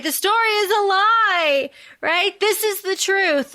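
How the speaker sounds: high, defiant proclamation to the crowd; mock yell to the mob. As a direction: declare it up high like a rallying cry. Yelling at the mob outside castle.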